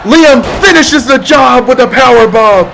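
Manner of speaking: high shouting play-by-play; excited shout. Real, not acted. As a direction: yell the finisher. Finisher on fallen climber.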